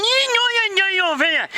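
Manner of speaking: very high, garbled protest; indignant Vicky. Acted. Vicky disputes the boss's explanation, high garbled insistence. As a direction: insist in a mangled, high put-on voice.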